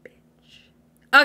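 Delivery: a sudden whispered slur at the end of the rant; whispered insult. Acted. Commentator whispering an insult about CJ. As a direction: hiss the insult out under your breath.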